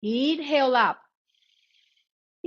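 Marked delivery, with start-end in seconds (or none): 2.4-2.5 long, higher-pitched ee; sustained "ee". Shown as a demonstration. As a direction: hold ee steadily on one high note. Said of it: "We're gonna say".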